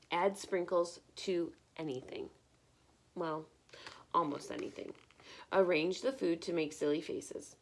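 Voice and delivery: lively picture-book read-aloud; bright, expressive storytime reading; reading to children. Acted. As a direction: read it like storytime for little ones.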